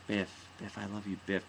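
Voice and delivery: soft start of a mock outburst; imitated Willy. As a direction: begin softly, about to build. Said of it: "everything had this pattern".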